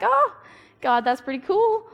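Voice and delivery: sudden delight. Retold or imitated. Mock realization that God was right after all, bursting high. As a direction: let it dawn on you all at once.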